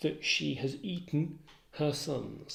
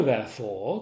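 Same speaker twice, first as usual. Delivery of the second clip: usual speaking voice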